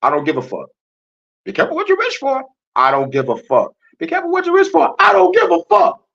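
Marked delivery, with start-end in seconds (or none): 1.5-2.4 very high squeaky mock voice; high-pitched mimicry of cautious people; mocking naysayers. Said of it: "Motherfuckers be like". 4.0-5.0 very high squeaky mock voice; high-pitched mimicry of cautious people; mocking naysayers. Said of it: "Motherfuckers be like". 5.0-6.0 very high, loud falsetto yell; hammed-up defiant outburst at the top of the voice; defiant screech. Escalated retort to mocked warning.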